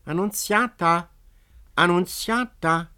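high, loud call through the woods; ringing high call across the forest; silvery call. A narrator's reading. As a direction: call her name from afar, ringing. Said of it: "rief eine silberhelle Stimme durch den Wald".

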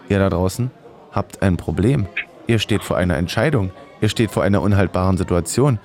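ordinary talk, nothing marked

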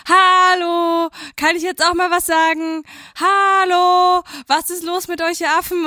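high, loud drawn-out mock hollering; imitating shouting. Woman retells caller screaming at the hosts, mock shouting. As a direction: mimic a heckler yelling for attention. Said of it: "der hat die ganze Zeit so rumgeschrien".

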